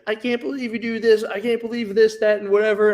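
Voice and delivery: high-pitched imitation of an outraged critic; mock screaming. Imagined critics berating him on a show, mock screaming. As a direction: pitch it way up like an angry complainer. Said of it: "they're just gonna be screaming at you".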